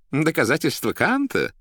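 high, loud objecting question; образованный редактор. A narrator's reading. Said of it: "возразил образованный редактор". (высоким голосом, с возражением)

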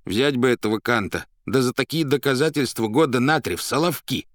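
loud, high, blunt outburst; Иван Николаевич. A narrator's reading. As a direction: blurt it out abruptly and loudly. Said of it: "совершенно неожиданно бухнул Иван Николаевич". (громко бухнуть, неожиданно)

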